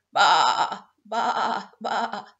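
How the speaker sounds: loud bleats from the dog; sheepdog bleating. Acted. Sheepdog can only say.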